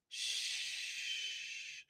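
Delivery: soft unvoiced shh; whispered shush. Shown as a demonstration. Coach showing how she shushes her mind, whispered. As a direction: hush it gently. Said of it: "Just a quick".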